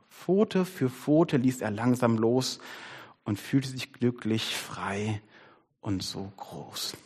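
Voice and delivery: soft, slow, low storybook ending; hushed, unhurried reading to children; tender story reading. A narrator's reading. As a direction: let it sink gradually to a near whisper. Reads the gentle story ending.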